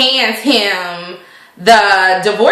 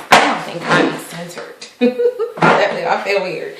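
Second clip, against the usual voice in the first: ordinary talk, nothing marked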